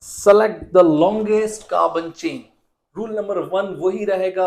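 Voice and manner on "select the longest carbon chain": slow dictation. Shown as a demonstration. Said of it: "teacher repeats the rule".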